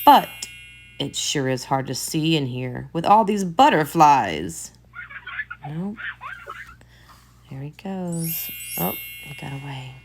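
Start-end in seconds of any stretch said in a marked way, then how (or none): 5.7-9.9 quiet, hushed aside; hushed voice tracking the chase; hushed commentary. Real, not acted. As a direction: murmur as if not to disturb. Quiet commentary over the video.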